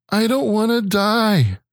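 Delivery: put-on high, slow, plaintive child voice; mock little-boy lament; seven-year-old son. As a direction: imitate a small kid's drawn-out whine. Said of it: "my seven-year-old".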